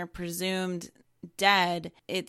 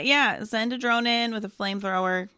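ordinary delivery

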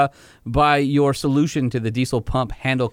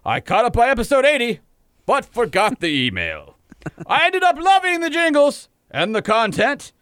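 blustery Southern rooster voice; 7 semitones higher than usual. Acted. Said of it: "are you Southern without the accent".

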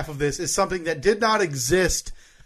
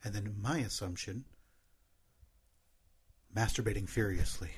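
ASMR whisper; 5 semitones lower, 13 dB quieter than usual. Pretend. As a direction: keep the hushed ASMR voice going. Keeps ASMR voice for a bit.

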